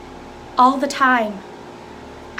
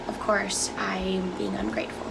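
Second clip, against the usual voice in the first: soft, defeated murmur, trailing away; wife backing down, hurt and quiet; resigned; 6 semitones lower, 12 dB quieter than usual. Acted.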